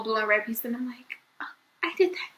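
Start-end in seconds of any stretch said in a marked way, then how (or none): 1.4-2.3 high, whispered realization; guilty whisper. Retold or imitated. Guilty whisper seeing the broken toy in the mirror. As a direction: breathe it out in guilty shock.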